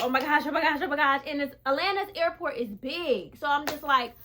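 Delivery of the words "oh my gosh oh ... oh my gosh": louder, rushed panic; panicked. Retold or imitated. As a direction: blurt it out in a mild panic. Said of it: "I started panicking again".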